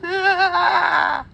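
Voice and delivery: a high shout of pain, hammed up; pained wail. Child after the cut wails to the doctor in pain.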